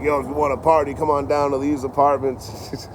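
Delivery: imitated party guy. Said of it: "He's like".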